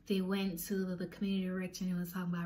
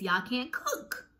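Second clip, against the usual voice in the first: "Y'all can't cook" slow and pitched up; emphatic verdict; 4 semitones higher, much slower than usual. Retold or imitated. Resting the case against roommates, "Y'all can't cook" slow and emphatic.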